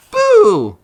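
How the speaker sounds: a sudden, very high and loud boo; shouted boo. A narrator's reading. Little mouse shouts boo to scare the elephant. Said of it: "shouted a little gray mouse".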